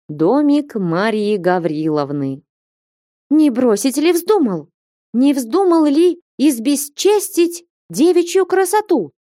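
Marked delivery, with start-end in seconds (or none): 3.3-9.1 high, furious accusing cry; angry shout. A narrator's reading. Flyonushka advancing on Alexei, high furious accusation. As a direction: accuse him at the top of the voice. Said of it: "крикнула Флёнушка".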